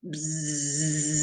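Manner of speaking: long, soft bee buzz; quiet drawn-out buzzing. Shown as a demonstration. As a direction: buzz like a bee on one low, sustained note. Imitates the bee.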